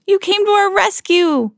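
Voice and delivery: high, thrilled cry of relief; joyful cry. A narrator's reading. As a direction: cheer the rescuer gratefully. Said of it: "cried Donald".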